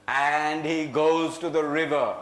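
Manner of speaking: usual speaking voice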